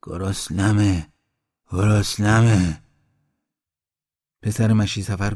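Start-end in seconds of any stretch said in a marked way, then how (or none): 0.0-2.8 drawn-out, pleading moan; weak creature moaning, growing louder on the repeat; slow moan. A narrator's reading. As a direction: moan it very slowly, stretching each word. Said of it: "آهسته نالید".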